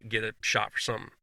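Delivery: normal talking voice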